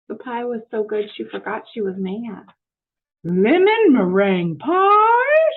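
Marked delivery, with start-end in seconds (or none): 3.2-5.5 loud, high, drawn-out delight; hammed-up joy at the pie; delighted exclaim. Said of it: "she exclaimed".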